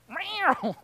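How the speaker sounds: high mock cat meow; a kicked cat's yelp; cat yowl. Preacher playing the cat underfoot, high mock meow. The cat crossing your path.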